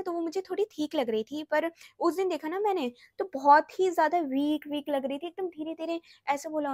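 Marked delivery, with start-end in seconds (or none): none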